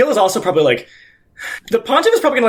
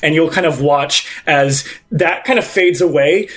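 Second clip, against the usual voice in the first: ordinary talk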